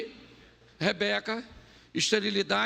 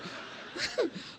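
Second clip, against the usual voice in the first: airy laugh; 11 semitones higher, 7 dB quieter than usual. Real, not acted. Laugh after joke.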